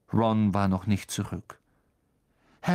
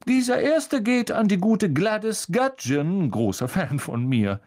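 high, louder, pompous voice; Lockhart; 8 semitones higher, 4 dB louder than usual. Acted. Lockhart bragging to Harry about a fan, high and vain. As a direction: preen over your adoring fan.

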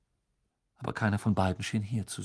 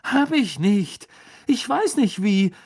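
Harry protesting; 10 semitones higher, 7 dB louder, slower than usual. Acted. Harry denies to cheering crowd.

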